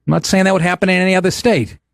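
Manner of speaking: ordinary talk, nothing marked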